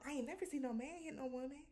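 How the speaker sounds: hushed, high gossiping voice; gossipy hush. Retold or imitated. Cast gossiping at dinner in a hushed high voice. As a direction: whisper the gossip under your breath. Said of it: "they all like".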